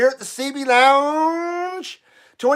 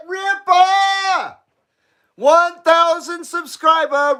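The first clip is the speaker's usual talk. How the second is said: high excited cheer; excited call; 7 semitones higher, slower than usual. Real, not acted. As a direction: call it out with glee. Cheering excitedly before smoking.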